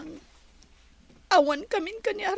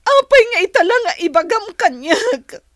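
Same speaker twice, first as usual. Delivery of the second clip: loud sobbing plea; shouting through tears; crying; 3 semitones higher, 10 dB louder than usual. Acted. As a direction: cry the words out. Crying at breakup.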